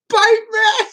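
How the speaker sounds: high, loud yell at a cat; beg-shout it, hammed-up; mock yelling. Joking streamer demands a cat's bite, mock yell. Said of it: "I'm yelling at a cat".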